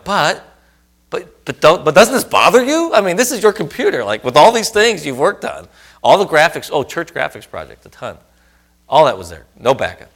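high, loud, insistent pleading to wife; intense frustration. Retold or imitated. Husband pressing his wife about the lost work, loud frustrated. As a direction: push her to share your panic. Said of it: "they get more intense sometimes".

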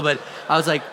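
ordinary talk

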